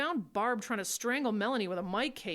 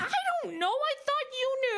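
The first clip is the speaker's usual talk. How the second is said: laughing shout, loud and high; yelling through a laugh; 5 dB louder than usual. Acted. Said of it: "because you're yelling".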